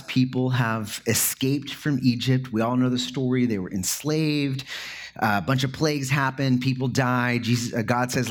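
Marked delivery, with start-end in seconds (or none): none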